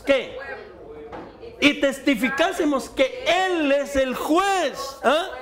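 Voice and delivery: high-pitched, emphatic sermon delivery; fervent preaching. Real, not acted. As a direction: preach it out, stretching the key words. Preaches at full force. (prédica enérgica y aguda)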